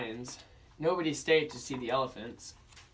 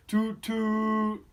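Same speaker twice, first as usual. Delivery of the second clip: high, loud mock horn toots; boat horn toot; 8 semitones higher, 5 dB louder than usual. Narrator imitating the boats to a child listener, high tooting. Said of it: "went the boats".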